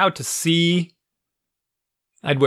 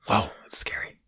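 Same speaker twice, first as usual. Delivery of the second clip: mock-scared whisper; 8 dB quieter than usual.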